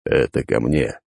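low, lifeless scraping voice; creaky dead voice. Acted. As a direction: rasp it out like something inhuman. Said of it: "проскрипел Аид".

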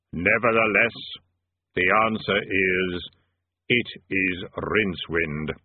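low, slow, hollow Death voice; grim reaper voice. A narrator's reading. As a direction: speak slow and deep as Death. Death speaks.

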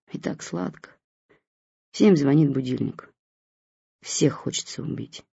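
plain reading voice

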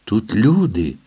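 lightly voiced entranced wonder; as if spellbound. A narrator's reading. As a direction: say it as if bewitched by the sight. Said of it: "мов зачаклований, твердив Джефрі".

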